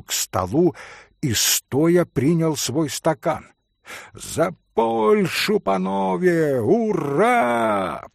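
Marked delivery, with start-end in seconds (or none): 4.1-8.1 high, slow rousing cheer; mild mock shout of a toast. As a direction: cry out the toast with hurrah. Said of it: "прокричал Митя".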